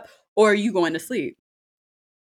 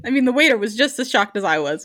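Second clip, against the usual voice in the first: normal talking voice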